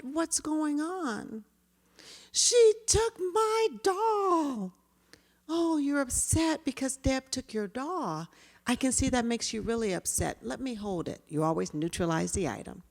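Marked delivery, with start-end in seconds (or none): 0.0-1.4 What's going on asked very slowly, higher and soft; calm gentle question. Shown as a demonstration. Teacher asking upset children what happened, tender. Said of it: "So I approach calmly". 2.3-4.7 put-on upset little child. Child answers the teacher. 5.5-11.2 name the feeling gently; calm reassurance. Shown as a demonstration. Teacher calmly validating a child over a doll. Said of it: "So I approach calmly".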